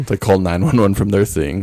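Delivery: ordinary delivery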